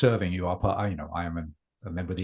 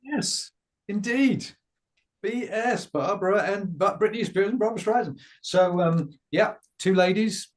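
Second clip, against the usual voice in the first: show host voice; 10 semitones higher, 4 dB louder than usual. Acted. Introduces guests on the show.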